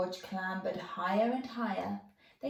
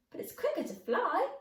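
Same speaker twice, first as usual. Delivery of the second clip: pixie; 9 semitones higher, faster than usual. Acted. Pixie grins and says.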